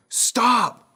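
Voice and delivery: high, loud yell of "stop"; mom's retold scream. Mom hollering from the living room at her sons, screamed. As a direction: yell it like a fed-up mother. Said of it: "scream from the living room".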